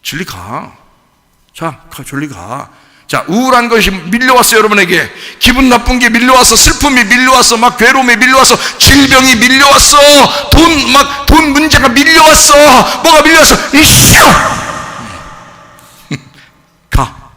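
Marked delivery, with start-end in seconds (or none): 0.0-2.7 softer, calm, cool dismissal; unbothered. Acted. Paul calmly telling the trouble to go away, unbothered. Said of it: "눈 하나 꿈쩍 안 하고". 13.8-17.3 shout. Acted. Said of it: "이러란 말이죠".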